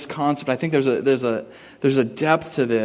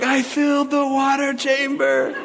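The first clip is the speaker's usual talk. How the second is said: put-on high, slow, gloating kid voice; triumphant kid taunt; 10 semitones higher than usual. Dad imitating his daughter's high, slow gloating taunt. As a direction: gloat like a kid who won.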